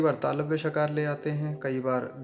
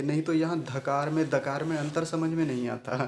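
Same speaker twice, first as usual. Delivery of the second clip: ordinary delivery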